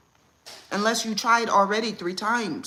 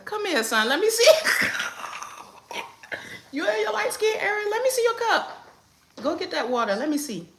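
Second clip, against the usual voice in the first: high, louder teasing mom voice; high sing-song mom; 11 semitones higher, 3 dB louder than usual. Real, not acted. Mom talking to toddler son.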